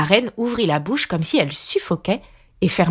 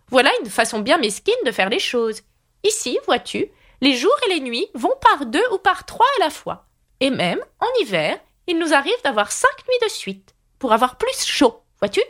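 high, haughty queen, lecturing; Red Queen voice; 9 semitones higher than usual. A narrator's reading. Red Queen scolding Alice's petty ways, in a high haughty voice. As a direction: talk down to her from way up high.

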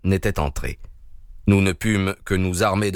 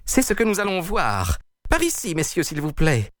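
much higher, shrill-toned indignant lady; indignant woman; 9 semitones higher than usual. A narrator's reading. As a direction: appeal to the police in outrage. Woman calls the police. (voix de femme indignée, beaucoup plus aiguë)

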